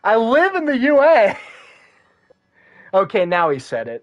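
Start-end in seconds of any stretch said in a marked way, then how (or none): 0.0-1.4 excited. Real, not acted. Joking about his state.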